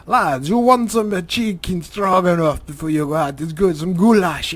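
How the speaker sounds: loud, high mock Ukrainian mother; attempted Russian-Ukrainian accent. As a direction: push food on guests in a badly faked Russian accent. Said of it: "and she'd be like".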